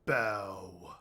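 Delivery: a low, booming one-word command; the giant lord's booming order to the party. Acted. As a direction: boom it down at them like a king. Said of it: "in a booming voice says".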